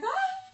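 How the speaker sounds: sudden high yelp of fright; re-enacted scared scream; startled shriek. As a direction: jump and squeal at the snake. Sees cobra at door.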